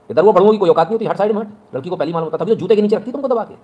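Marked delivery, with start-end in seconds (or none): 0.1-1.7 higher and louder, brushing them aside; loud dismissive rant. Real, not acted. Speaker shooing off drunkards in front of listeners, loud contemptuous rant. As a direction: shove them away with your voice.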